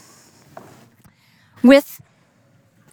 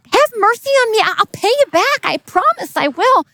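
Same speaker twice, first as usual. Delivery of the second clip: pleading; 11 semitones higher than usual. Acted. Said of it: "And the guy says".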